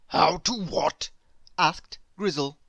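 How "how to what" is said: a heavy bear, puzzled and dull; gloomy bear voice. Acted. Grizzle the bear puzzled by Squeak, gloomy bear voice.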